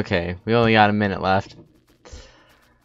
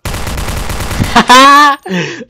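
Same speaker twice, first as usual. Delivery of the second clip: high, loud cackle; loud burst of laughter; 12 semitones higher, 9 dB louder than usual. Real, not acted. Gamer caught out in a zombie game, high loud laugh.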